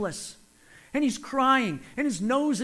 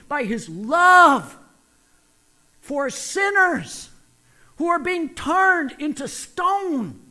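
loud, slow preaching with rising force; 5 semitones higher, 7 dB louder, slower than usual. Acted. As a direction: preach it hard and drawn out.

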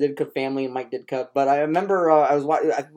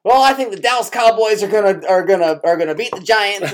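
put-on higher, louder confused old coach voice; rambling Mike Ditka; 5 semitones higher, 5 dB louder than usual. Retelling a TV panel, imitating Mike's confused answer. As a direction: play the coach missing the question entirely. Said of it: "And Mike goes".

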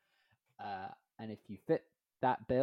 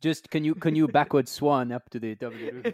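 louder mock French accent; 4 semitones higher, 8 dB louder than usual. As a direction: ask it like a cheeky French thief. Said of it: "keep doing the French accent".

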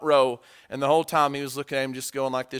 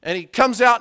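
normal talking voice

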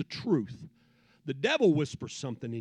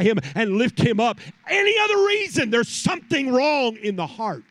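climbing louder and higher to a shouted love; full-force preaching; 6 semitones higher, 8 dB louder than usual. Acted. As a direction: preach it out with rising fire.

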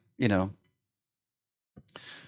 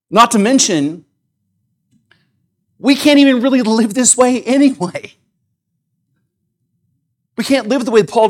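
raised, pressing sermon voice, driving each point home; forceful preaching; 6 semitones higher, 4 dB louder than usual. Acted. Pastor pressing the church on the gospel, forceful preaching. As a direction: preach it hard to the congregation.

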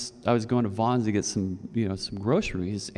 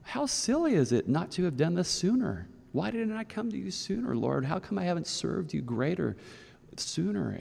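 high, pleading inner voice to the Lord; eager regret; 8 semitones higher than usual. Retold or imitated. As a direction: voice the regret, lifted and earnest. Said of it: "you're thinking".

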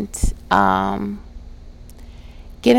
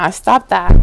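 emphatic; 10 dB louder than usual. Real, not acted. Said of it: "repeats phrase".

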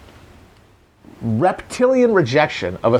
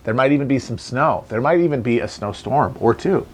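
ordinary delivery

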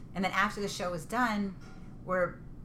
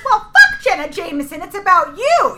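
loud mom yell; 12 semitones higher, 15 dB louder than usual. Retold or imitated. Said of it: "She goes".

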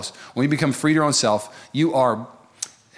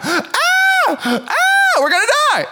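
high, loud mock screaming; mock panic; 4 dB louder than usual. Israelites panicking at the sea, high mock screams. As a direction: shriek like a panicking crowd. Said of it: "everybody else is freaking out".

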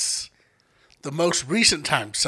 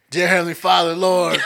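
louder preacher-style prayer; praying; 2 semitones higher, 8 dB louder than usual. Retold or imitated. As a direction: call on the Lord like a preacher. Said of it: "And I start praying".

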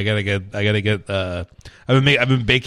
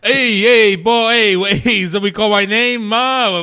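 pizza guy voice; 8 semitones higher than usual. Acted. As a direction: play a brash pizza delivery guy. S1 plays Pauly the pizza guy.

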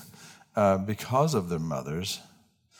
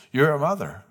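put-on higher, louder kid voice for Billy; child's answer; 5 semitones higher, 4 dB louder than usual. Pastor voicing the second grader blurting his answer, higher and louder. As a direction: pipe it up like an eager second grader.

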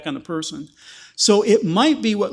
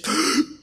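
sharp gasp; 9 semitones higher than usual. Retold or imitated. Tabitha's first breath.

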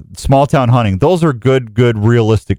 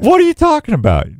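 much higher mock indignant protest; mock outrage. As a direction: play it shocked and offended.

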